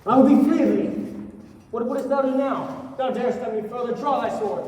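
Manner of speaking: angry challenge. Acted. Young man challenges his attacker.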